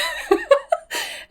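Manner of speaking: squealing high-pitched laugh. Real, not acted. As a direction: burst out in a high giggle. Laughing at her own reaction.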